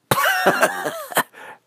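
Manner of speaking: high, sputtering mock laugh; acted guffaw at a silly name; bear's laugh. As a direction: burst out in a spluttery cackle.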